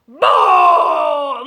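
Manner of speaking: sudden deep shouted boo; loud boo. A narrator's reading. Sheep shouting boo into dog's ear, loud. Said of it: "Muy fuerte le gritó".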